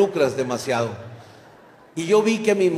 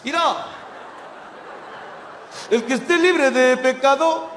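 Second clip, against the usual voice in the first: mock paisano Jesus; 11 semitones higher, slower than usual. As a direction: ham up a squeaky small-town Jesus. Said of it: "él aquí dice".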